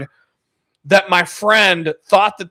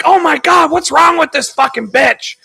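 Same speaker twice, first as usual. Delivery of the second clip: high, worked-up mock anger; hammed-up fed-up outburst; imitated mad friend; 10 semitones higher, 5 dB louder than usual. Buddy fooled by a clip, mock getting mad. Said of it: "like, getting mad".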